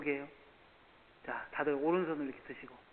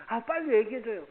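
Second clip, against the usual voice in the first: eager pleading; 7 semitones higher, 7 dB louder than usual. Retold or imitated. Eager listener begging.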